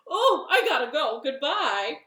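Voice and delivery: loud string of noes, then rushed; panicked snake. Acted. Snake flees from the Gruffalo, panicked and loud. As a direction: blurt a hammed-up getaway.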